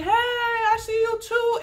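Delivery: high, drawn-out hollering greeting; imitated scream. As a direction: holler across the water like an upbeat boatman. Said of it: "he is screaming".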